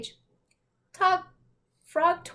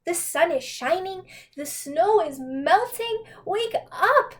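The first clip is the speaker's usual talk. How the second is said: higher, slower, rising to a high wake up; eager urging; 6 semitones higher, 4 dB louder than usual. Acted. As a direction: coax him out with excitement. Frog urging Toad awake.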